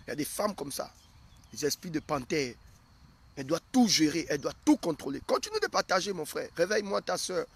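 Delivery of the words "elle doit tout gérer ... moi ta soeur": usual speaking voice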